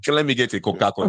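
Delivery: put-on Nigerian-accented offer, Coca-Cola as koh-KAH-koo-la. Imitating a Nigerian offering a drink, Nigerian accent. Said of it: "In Nigeria, they say Coca-Cola".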